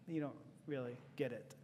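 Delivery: very soft, low aside; muttered resentment. Acted. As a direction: say it under your breath, unconvinced. Muttered reply to false sympathy.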